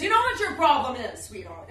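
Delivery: patronizing opener before a lecture; condescending scold. Retold or imitated. Famous woman scolding a younger guest, loud and patronizing. Celebrity scolds her at dinner.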